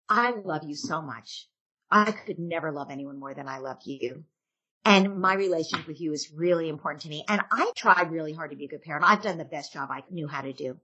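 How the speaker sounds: hushed, heartfelt parent confession; sincere apology. Pretend. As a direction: apologize tenderly to your child. Parent apologizing to their child.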